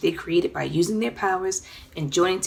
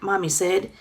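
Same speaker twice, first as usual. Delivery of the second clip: plain reading voice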